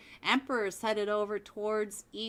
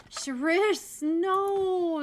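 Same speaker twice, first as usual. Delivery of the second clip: higher, louder anguished no; dismayed cry; 9 semitones higher, 7 dB louder, much slower than usual. Real, not acted. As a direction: groan out the loss of your player. Teammate downed, caster dismayed.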